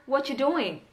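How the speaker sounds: 'What you doing' run together as 'whatchu doing'; casual linked 'whatchu' in 'What you doing'; reduced 'What you doing'. Shown as a demonstration. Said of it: "orang menyingkatnya dengan".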